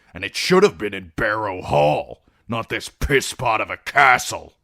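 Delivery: a spoiled son raising his voice; loud, whiny grievance; petulant complaint. Acted. Ramsay complains to his father.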